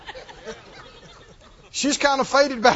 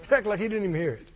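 usual speaking voice

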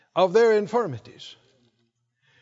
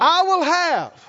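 slow, raised-pitch proclamation; out loud, like you mean it; 8 semitones higher, 5 dB louder, slower than usual. Shown as a demonstration. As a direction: lead the congregation with drawn-out conviction. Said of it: "say it like you mean it".

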